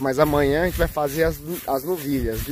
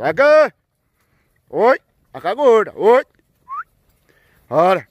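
high, loud herding yells; loud calls to push the herd along; cattle calls; 8 semitones higher, 8 dB louder, slower than usual. Real, not acted. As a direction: holler to drive the cows on. Herding fat cows along.

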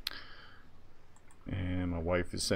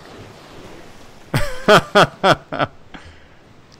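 loud laugh; 13 semitones higher, 13 dB louder than usual. Real, not acted. Laughs at the cyber joke.

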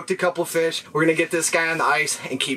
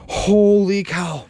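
amazed; 6 semitones higher, 4 dB louder, much slower than usual. Real, not acted. After landing fish.

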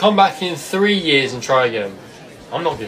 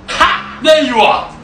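triumphant shout; 8 semitones higher, 5 dB louder, slower than usual. Acted. Staff finds the hiding kid.

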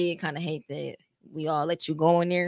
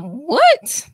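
high, loud re-enacted shock; shocked disbelief; 11 semitones higher, 14 dB louder than usual. Friend reliving the news of a death, shocked outburst. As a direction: burst out in stunned disbelief.

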